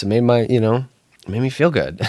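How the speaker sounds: usual speaking voice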